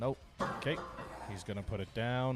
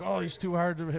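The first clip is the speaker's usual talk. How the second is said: higher-pitched imitation of a grumbler; mock complaining; 7 semitones higher than usual. Commentator imitating lifters who blame the ref, mock complaint. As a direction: imitate people griping about the judge. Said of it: "might complain and been like".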